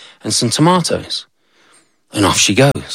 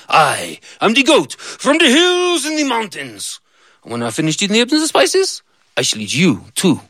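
menacing goat; 8 semitones higher than usual. Acted. Goat threat.

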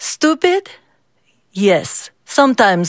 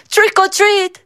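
shouted; 13 semitones higher than usual. A narrator's reading. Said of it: "the kids shouted".

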